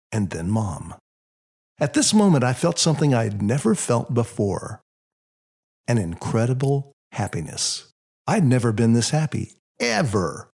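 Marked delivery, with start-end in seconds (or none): none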